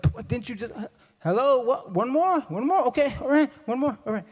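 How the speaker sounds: high, incredulous questions; worked-up husband, pitched high; mock exasperation. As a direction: challenge in rising comic disbelief.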